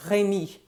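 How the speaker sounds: Rémi as "ray-MEE" with a throaty R; French pronunciation of Rémi. Shown as a demonstration. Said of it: "in French it's".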